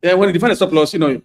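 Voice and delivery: ordinary talk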